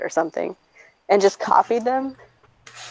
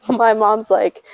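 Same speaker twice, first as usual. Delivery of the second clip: usual speaking voice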